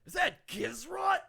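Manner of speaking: high, slow, then a loud surprised shout; amazed whoa. Acted. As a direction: gasp and shout at the sight. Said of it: "he goes".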